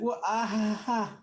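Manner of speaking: high stammering, sheepish excuses; high flustered noble voice, stumbling over words; flustered councilman. Acted. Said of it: "It's like".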